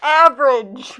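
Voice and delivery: loud call from another room. Pretend. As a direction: shout it from the next room. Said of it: "From the other room".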